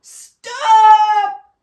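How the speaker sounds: high, loud shouted cry; a frightened crowd shouting to stop the diver. A narrator's reading. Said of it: "they cried".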